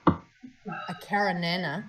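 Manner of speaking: "Caranana" drawn out slowly, relishing each syllable; savoring the word. Shown as a demonstration. Host who loves saying the flavour name, "Caranana" drawn out. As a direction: roll "Caranana" around lovingly. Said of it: "I like saying this".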